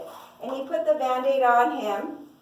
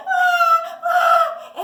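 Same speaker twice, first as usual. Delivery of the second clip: put-on loud, high-pitched baby wail; mock baby crying; 14 semitones higher, 9 dB louder than usual. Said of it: "And he would cry".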